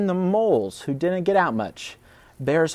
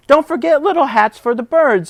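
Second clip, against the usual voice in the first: high, louder squirrel voice; fussy squirrel; 11 semitones higher, 7 dB louder than usual. Acted. Other squirrel fussing about bird hats, high squirrel voice. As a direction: squeak out the reminder like a small fussy squirrel.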